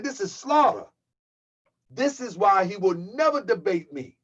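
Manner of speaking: normal talking voice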